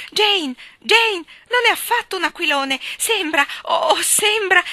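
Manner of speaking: high, loud, thrilled shouting; cry of joy. A narrator's reading. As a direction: shout with joy, drawing out the last words. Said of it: "gettò un grido di gioia". (grido di gioia, acuto e forte)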